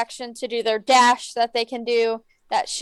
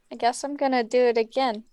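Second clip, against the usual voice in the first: ordinary talk, nothing marked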